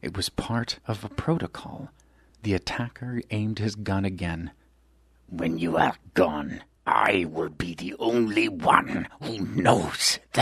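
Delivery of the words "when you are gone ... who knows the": a cold assassin's whispered threat; menacing whisper. Acted. Albino aims gun.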